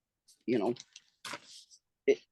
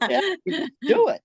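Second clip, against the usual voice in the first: laughing it out, voice jumping higher; 12 semitones higher, faster than usual. Real, not acted. Repeating the Nike slogan to the interviewer, laughing. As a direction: giggle through the slogan.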